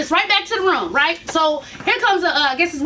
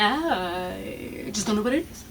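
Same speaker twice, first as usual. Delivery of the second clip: slow, much lower, much softer mock doctor; 9 semitones lower, 9 dB quieter, slower than usual. Mocking the sarcastic ER doctor, low slow drawl. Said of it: "that's not how you talk".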